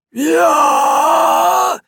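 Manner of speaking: high, loud croaking demon; shrill hellish croak. Acted. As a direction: croak it out like a monster. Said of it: "krächzte es".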